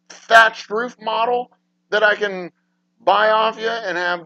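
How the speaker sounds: high, loud exasperated rant; indignant rant. Real, not acted. Streamer ranting to chat about Pizza Hut, exasperated. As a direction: rail at the clueless staff.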